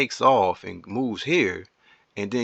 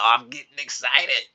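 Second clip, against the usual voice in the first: "I'm getting excited" said a bit higher, bubbling over; 4 semitones higher than usual. Real, not acted. Streamer telling viewers he is getting excited, bubbly. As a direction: let the excitement spill out.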